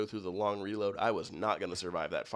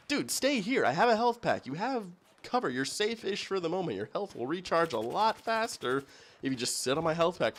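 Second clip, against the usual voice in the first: exasperated pleading; 8 semitones higher than usual. Acted. Pleading with teammate who runs off.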